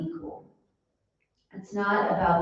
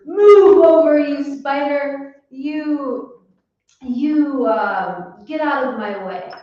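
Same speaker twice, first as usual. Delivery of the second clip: higher, louder mock bossy shove; bossy monkey; 7 semitones higher, 8 dB louder, slower than usual. The monkey shoving the spider away, mock angry. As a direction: push the spider aside, hammed-up. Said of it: "the monkey goes".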